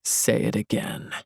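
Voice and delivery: low, hard, near-whispered threat; a cold, barely voiced warning. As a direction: menace it out, quiet and hard. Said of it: "in a low, hard voice".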